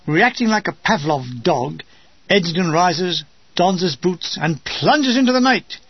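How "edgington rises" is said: ordinary delivery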